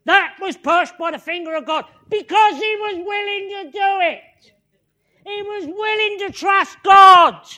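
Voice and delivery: loud, high-pitched sermon climax; preacher at full force, exultant and pushing; impassioned preaching. Acted.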